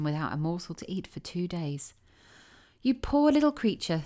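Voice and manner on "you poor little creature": put-on higher, louder old field mouse voice, full of pity; kindly field mouse. A narrator's reading. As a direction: pity the tiny girl as a good old mouse. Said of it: "said the field mouse".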